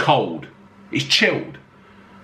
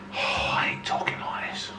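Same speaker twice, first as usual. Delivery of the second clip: embarrassed whisper, much softer; whispered aside; 10 dB quieter than usual. Real, not acted. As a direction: whisper it as if hiding the remark. Embarrassed aside about sounding pretentious.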